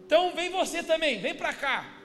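high, loud order, acted out; police summons. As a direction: call a suspect over with authority. Policial chama o narrador. (policial chamando aos gritos)

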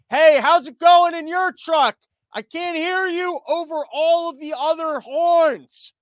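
high, loud, slow shout; mock shouting over noise. Trucker shouts to other truckers over blaring horns, slow loud yell.

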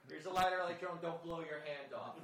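mock parent call.

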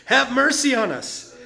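high, loud desperate cry; shouting for mercy. Acted. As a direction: call out across the crowd, pleading.

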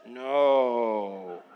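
low, drawn-out "no"; sarcastic long low no; mock denial. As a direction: answer with exaggerated irony. Sarcastic answer to own question.

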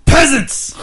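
loud, high roaring king; gravelly orc king bellow. Shown as a demonstration. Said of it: "kind of gravelly, a little lower".

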